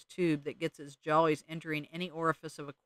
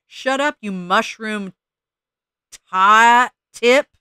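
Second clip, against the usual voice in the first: much higher, much louder bird scream; 5 semitones higher, 12 dB louder, much slower than usual. Acted. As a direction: shriek the insult at the top of the lungs. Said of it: "screamed the Junco".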